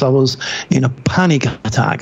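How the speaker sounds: usual speaking voice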